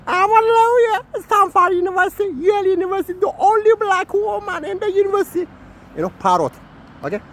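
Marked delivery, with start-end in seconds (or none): 0.1-5.5 mock Michelle Obama. Ranter mocks Michelle Obama in a high whining falsetto.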